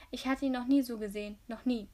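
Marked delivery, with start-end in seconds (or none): none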